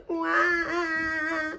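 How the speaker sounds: high, long mock baby cry; baby crying wail. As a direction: wail like an upset piglet. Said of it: "said Baby Pig".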